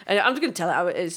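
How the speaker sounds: usual speaking voice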